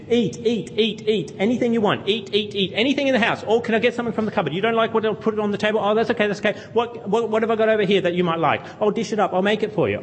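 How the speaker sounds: high, fast, fussing mother urging food; doting mum. Retold or imitated. Mum pressing food on her visiting son, high and fussing. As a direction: fuss over the guest like a doting mum. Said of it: "a mum that loves you very much".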